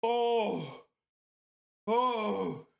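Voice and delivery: high, strained moans through the burn; pained groans. Real, not acted. Still burning from the candy, high moans of pain. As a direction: groan it out, mouth on fire. Suffering from spicy heat.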